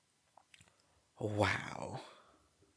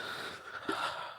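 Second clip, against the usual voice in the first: long breathy exhale; deep sigh; 6 dB quieter than usual. Real, not acted. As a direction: sigh it all out. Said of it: "calm my brain down".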